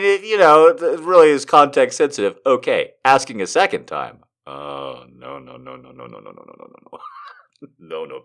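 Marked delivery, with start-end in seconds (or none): 4.5-8.2 low, very soft string of no's; fading murmur. Real, not acted. Reader dismayed at a second ask, murmuring lower and fainter. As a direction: murmur no's, sinking away to almost nothing.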